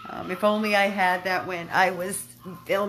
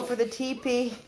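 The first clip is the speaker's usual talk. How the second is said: ordinary delivery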